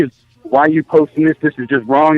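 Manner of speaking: normal talking voice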